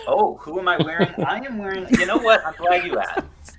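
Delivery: gushing red carpet chatter. Pretend. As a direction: gush like a star on the red carpet. Who are you wearing tonight?.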